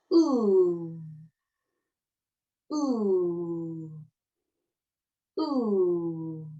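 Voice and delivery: long closed OU, lips rounded; a teacher's careful model vowel. Shown as a demonstration. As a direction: hold the OU long and low. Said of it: "Allons-y encore une fois".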